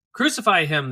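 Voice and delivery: loud, high crowd shout; shouted demand. A narrator's reading. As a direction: hurl it back at the governor. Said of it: "they all shouted back".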